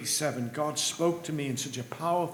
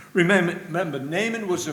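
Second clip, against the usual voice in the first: emphatic urging; 4 semitones higher, 7 dB louder, faster than usual. Real, not acted. Preacher urging the congregation.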